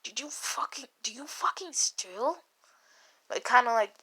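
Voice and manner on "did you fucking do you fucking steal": hushed whispered question; much softer, under the breath; whispering. Acted. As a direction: whisper it secretly to the cat. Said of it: "like, he whispers".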